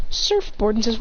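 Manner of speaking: ordinary delivery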